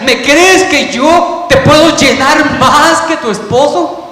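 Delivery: God urging the woman to believe, calling out; fervent plea. Acted. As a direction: press the question as a cry.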